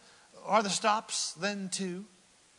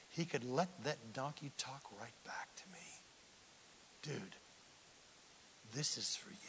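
softer, whispered donkey reply; 3 semitones lower, 12 dB quieter than usual. Acted. Donkey whispering a warning to the preacher. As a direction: lean in and whisper it.

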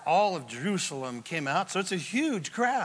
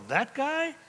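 incredulous crowd; 8 semitones higher than usual. Acted. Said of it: "They're all".

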